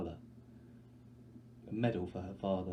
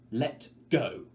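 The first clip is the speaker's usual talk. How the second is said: louder, sharp command; harsh shout; 4 semitones higher, 8 dB louder than usual. Acted. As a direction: force yourself to be hard. Shaking her sister off.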